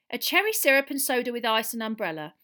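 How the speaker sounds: lightly voiced barmaid calling out a drink; barmaid reading orders. A narrator's reading. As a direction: call the order over the table.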